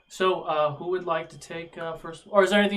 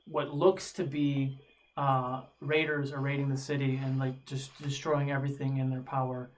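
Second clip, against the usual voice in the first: ordinary talk, nothing marked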